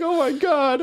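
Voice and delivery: high, hammed-up gasp of a shocked lady; mock minion lady. Uncle's story, the pump lady hearing the news in a high mock shriek. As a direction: squeal it like the gossipy pump lady. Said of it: "She's like".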